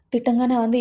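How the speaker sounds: ordinary delivery, nothing marked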